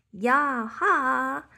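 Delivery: loud cheering yell; playful shout. Acted. Pip yelling in their noisy game, playful shout. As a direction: holler with joy. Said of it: "shouted Pip".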